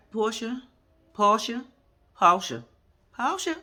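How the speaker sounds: repeated name, each one a separate call; insistent pretend hailing; mock calling out. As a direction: try to get her attention, pausing between calls. Calling Portia to get her attention.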